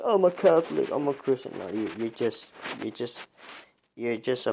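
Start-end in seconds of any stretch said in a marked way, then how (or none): 0.0-1.5 mocking quote. Mocking people who profess faith.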